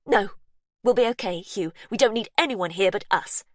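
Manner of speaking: cling to the house, defiant; Verity, stubborn. A narrator's reading.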